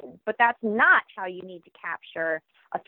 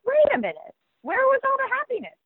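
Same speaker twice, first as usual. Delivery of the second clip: much higher, startled mock disbelief; baffled parent. Parent picking up a grumpy preschooler, high startled disbelief. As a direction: squeak it out like a bewildered parent. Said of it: "And you're like".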